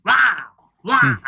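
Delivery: high grunting rah rah; grunting footballers. Retold or imitated. Footballers interrupting the eulogy, grunting rah rah. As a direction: bark out rah like jumping players. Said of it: "someone was saying".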